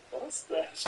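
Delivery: high, startled mock question; mock shocked voice. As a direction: act out a startled gasp of a question. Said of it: "I go".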